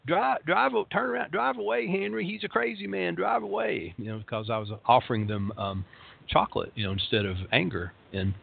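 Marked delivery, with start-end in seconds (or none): 0.1-3.9 high, panicked urging to drive away; frightened wife. Retold or imitated. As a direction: play the scared wife begging her husband to flee. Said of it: "she said".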